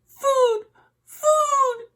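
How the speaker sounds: very high tiny peep; peeping gosling. A narrator's reading. Hungry gosling peeping at the robot, tiny and very high. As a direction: squeak it like a newborn chick. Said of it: "peeped the gosling".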